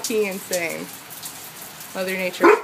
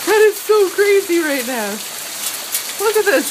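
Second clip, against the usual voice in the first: loud, higher exclamation; thrilled; 7 semitones higher, 6 dB louder than usual. Real, not acted. Filming the storm for viewers, loud thrilled exclaim. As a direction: let the excitement burst out.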